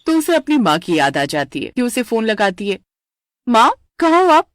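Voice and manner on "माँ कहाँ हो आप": higher and louder, eager call; excited daughter on the phone. Acted. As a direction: call to mom down the phone line. Daughter calls mother on phone.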